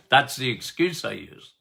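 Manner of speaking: ordinary talk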